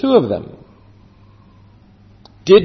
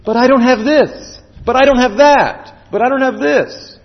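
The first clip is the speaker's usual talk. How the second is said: higher-pitched mock protest; protesting excuses; 8 semitones higher, 4 dB louder than usual. Preacher mimicking listeners' excuses, high-pitched protest. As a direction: plead each excuse in the same raised tune.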